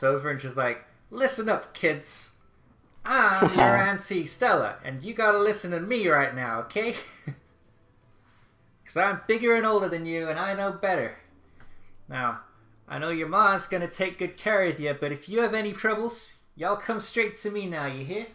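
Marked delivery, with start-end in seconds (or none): 1.1-4.8 loud, high Southern American aunt, puffed-up; a bossy Southern American auntie calling the kids to order; Southern American accent. Acted. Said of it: "I have always given her a Southern American accent". 4.8-18.3 loud, high Southern American aunt, puffed-up; a bossy Southern American auntie calling the kids to order; Southern American accent. Acted. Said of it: "I have always given her a Southern American accent".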